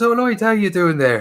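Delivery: put-on a cheery Irish lad greeting his mate in the Irish accent; a hint of an Irish brogue. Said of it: "He says".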